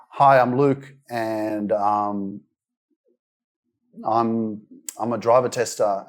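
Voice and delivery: hesitant, stumbling self-introduction with pauses; nervous stammering intro. Retold or imitated. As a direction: get the words out while scared to be seen. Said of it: "I'm freaking out".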